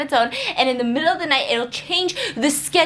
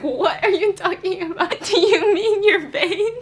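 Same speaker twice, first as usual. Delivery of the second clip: incredulous; 6 semitones higher, slower than usual. Retold or imitated. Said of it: "I'm like".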